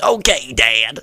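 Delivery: cartoon kid impression. Shown as a demonstration. Comic demonstrating the son's reply to dad, cartoon kid voice. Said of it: "And I could do, like".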